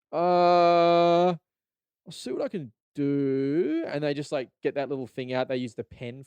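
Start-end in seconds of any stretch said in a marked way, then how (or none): 0.1-3.9 slow, drawn-out hesitation; salesman stalling. Retold or imitated. Salesman pretending to consider a discount, slowly. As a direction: stall as if thinking hard. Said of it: "they're like".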